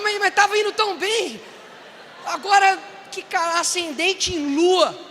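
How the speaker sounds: high, loud and slowing down in mock disbelief; hammed-up shock at the horoscope. Shocked listener reacting to horoscope.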